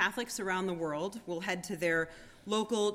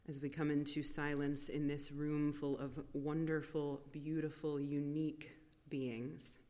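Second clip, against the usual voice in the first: soft spa whisper; 5 semitones lower, 9 dB quieter than usual. Acted. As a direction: speak gently into the silence. Soft meditative reflection.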